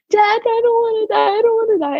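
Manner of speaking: acted-out frightened plea, held back in volume; retold screaming. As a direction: plead with dad in a high, panicked cry. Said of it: "I'm screaming at my dad".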